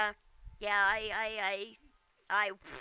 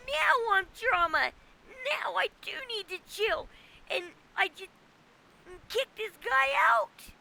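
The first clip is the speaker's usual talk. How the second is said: much higher and louder, slowed on "kick this guy out"; real exasperation; 6 semitones higher, 3 dB louder than usual. Real, not acted. Telling her stream why she kicked him, heated. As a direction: let the frustration boil over. Venting about being called drama.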